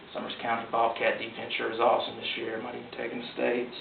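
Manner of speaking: soft lightly voiced old country man; old local man. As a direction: mumble it like an old man with a chew. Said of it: "an old man ... spitting ... and saying".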